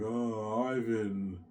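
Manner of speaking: drawn-out mock moan; retold tearful call; imitated crying. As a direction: imitate the wife wailing his name. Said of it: "I hear my wife cry".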